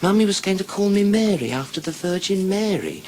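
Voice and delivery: put-on high little-girl storytelling voice; put-on childish treble. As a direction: explain it as a small child, pitched high. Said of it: "She says".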